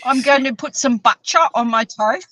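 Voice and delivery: playful imitation of his accent, butter said as butcher; lightly voiced imitation of a friend's accent; teasing mimicry of a friend's accent; mock Neil accent. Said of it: "how does he say butter".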